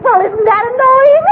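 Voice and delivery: ordinary talk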